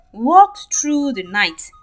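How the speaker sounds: plain reading voice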